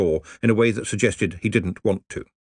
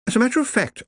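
prim, higher voice for a lady librarian; faster than usual. Acted. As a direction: speak up as a proper lady. Marjorie's voice in story.

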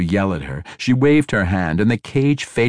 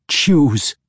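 loud, higher sharp demand; 8 semitones higher, 5 dB louder than usual. Acted. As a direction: bark the order at him. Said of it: "Ivanel demanded".

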